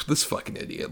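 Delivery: a contemptuous under-the-breath whisper, unvoiced; whispered contempt. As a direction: hiss it like she can't be bothered. Jolie's contempt voiced.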